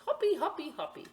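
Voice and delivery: "Hoppy" said with an o, first one high; hoppy instead of happy. Acted. Boy playing frog saying "Hoppy" instead of happy. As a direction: bounce "Hoppy, hoppy" like a child. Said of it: "he says".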